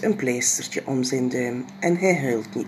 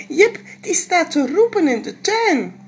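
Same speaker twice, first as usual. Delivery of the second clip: put-on much higher child's voice, unsure; a small girl who doesn't know; little girl voice; 12 semitones higher, 7 dB louder than usual. Janneke answering papa, high little girl voice.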